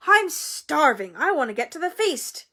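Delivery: put-on impatient hungry boy; eager hungry Ron. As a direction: whine for the feast.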